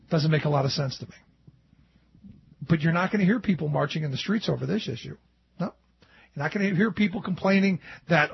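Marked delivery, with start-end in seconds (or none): none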